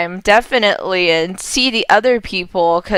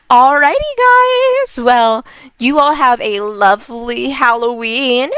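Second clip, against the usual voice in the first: cheery sign-off; 3 semitones higher, 4 dB louder than usual. Real, not acted. Sign-off to viewers.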